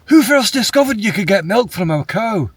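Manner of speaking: high Scottish Connolly voice; Billy Connolly impression. Quoting a Billy Connolly one-liner to a friend, Scottish. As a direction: quote him in a broad Scottish accent. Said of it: "he says".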